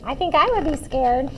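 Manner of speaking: ordinary delivery, nothing marked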